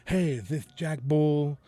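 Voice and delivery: slow, drawn-out Southern wrestler promo voice; Dusty Rhodes voice. Retold or imitated. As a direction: stretch it out like a wrestling legend on the phone. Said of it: "talking like Dusty Rhodes".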